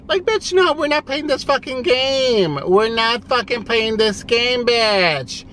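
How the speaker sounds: high-pitched, exasperated retort; real fed-up indignation, pitched high; indignant outburst. Real, not acted. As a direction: jump high in outrage and ease down slightly. Ranting at an absent ex-friend.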